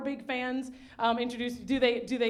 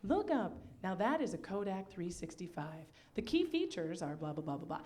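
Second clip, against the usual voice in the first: training recording voice; 4 semitones lower, 9 dB quieter than usual. Retold or imitated. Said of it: "it's me in there being like".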